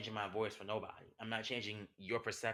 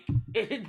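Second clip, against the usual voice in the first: short laugh; 10 semitones higher, 8 dB louder than usual. Real, not acted. Laughs at his own joke.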